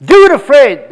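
"Do it afraid" called out high and loud; preacher punching "Do it afraid"; emphatic exhortation. Real, not acted. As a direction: shout "Do it afraid" as a rallying charge. Repeats motto for emphasis.

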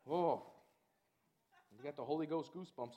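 normal talking voice

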